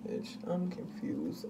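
slow hushed whispered aside; slow whispered confusion. Real, not acted.

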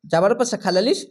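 ordinary delivery

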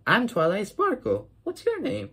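very high sweet cartoon pony greeting. Kid voicing Twilight greeting Jared, high and chirpy. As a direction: chirp it like a friendly little pony. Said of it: "and Twilight says".

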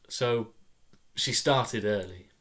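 usual speaking voice

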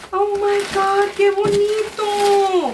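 real overjoyed squeal; delighted squeal. Real, not acted. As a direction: gush over the flowers in Spanish. Seeing crochet flowers.

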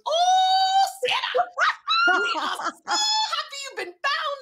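very high, loud, joyful retold shout; overjoyed uncle. Uncle on the phone greeting found niece, ecstatic shout. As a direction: burst out like a thrilled uncle on the phone. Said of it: "He goes".

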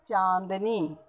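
चांदनी softly, with a nasalised long first vowel; correct pronunciation. Shown as a demonstration. Teacher models the nasal dot, soft चांदनी. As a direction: draw out the nasal "chaan" of चांदनी. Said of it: "चंद्र बिंदी होगी".

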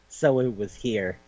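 soft, slowed mock revelation; quiet realization. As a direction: let it dawn slowly and softly. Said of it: "she goes like".

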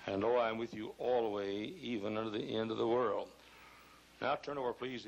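ordinary delivery

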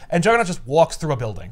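normal talking voice

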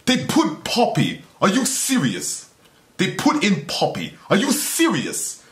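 high, slow, exasperated outrage; slow high-pitched indignant rant; outraged disbelief. Real, not acted. Outraged rant at Poppy in video.

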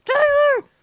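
loud, high scolding mother; angry mom. Acted. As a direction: yell at your kid as the mom. Mom calls out angrily again.

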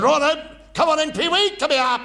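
high, loud drill sergeant bark; barking chief petty officer. Retold or imitated. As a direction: bellow it like a feared naval chief. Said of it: "And he said".

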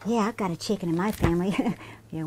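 ordinary delivery, nothing marked

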